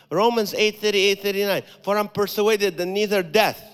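loud, ringing preaching of the verse; thundering sermon reading; full-force scripture recitation. Real, not acted. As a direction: proclaim it to the whole church.

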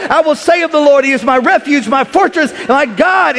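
loud preached declaration; proclaiming scripture. Acted. Preacher to the congregation, proclaiming scripture loudly. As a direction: declare it like a herald.

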